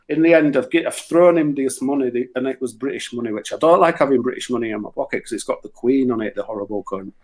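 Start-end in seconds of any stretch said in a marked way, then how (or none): none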